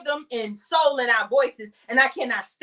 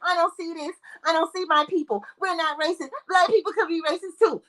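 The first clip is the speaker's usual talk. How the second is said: higher-pitched, mock-innocent denial; mocking imitation; 4 semitones higher than usual. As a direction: recite the excuses in a higher mocking voice.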